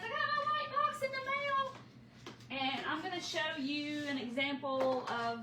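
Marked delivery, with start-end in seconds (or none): 0.0-2.6 high, soft, slow happy customer voice; quoting a pleased customer, soft and high. Retold or imitated. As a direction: chirp it like a delighted customer. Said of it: "Everybody says".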